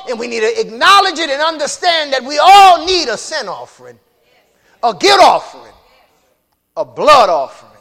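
loud, high, fervent preaching; preaching at full force. Acted. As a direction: shout the call to the congregation. Preaching builds to shouting.

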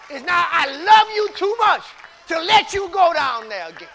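2 loud, high shouting sermon climax; 3 build it up and shout it to the back pews; 1 preaching at full force. Real, not acted. 4 preacher warning the church about the trail, shouting.

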